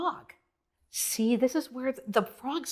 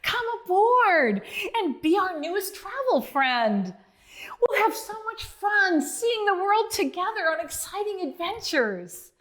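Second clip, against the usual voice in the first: squeal; 7 semitones higher than usual. Acted. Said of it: "Let Us Learn squealed".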